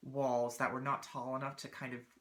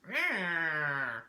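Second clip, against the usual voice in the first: indignant cat growl; 2 semitones higher, 4 dB louder than usual. Retold or imitated. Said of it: "indignant meow ever from Lil Muck".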